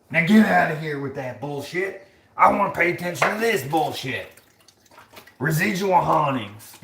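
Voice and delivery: high, loud scoffing parody; hammed-up outrage; mock indignant rant. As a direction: dismiss it in an overblown high voice. Continues mocking the commenter.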